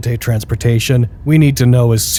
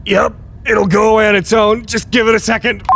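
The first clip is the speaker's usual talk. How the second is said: strained through pain; 7 semitones higher than usual. Acted. Strained through a coughing fit.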